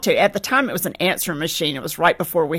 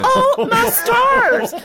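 high, slow, loud laugh through it; laughing exclaim; 6 dB louder, much slower than usual. Real, not acted. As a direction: laugh it out in disbelief. Laughing at her own embarrassment.

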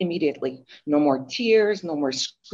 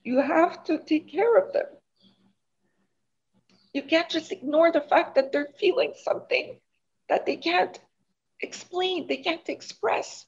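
emotional; 9 semitones higher than usual. Real, not acted. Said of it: "I don't want to get emotional".

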